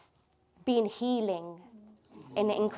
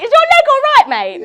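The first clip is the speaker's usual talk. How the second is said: much higher, louder teasing question; mock concerned mate; 16 dB louder, faster than usual. Quoting the reply to a friend's moan, hammed-up and high. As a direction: send up a mate's fake concern. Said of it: "he used to say it all the time".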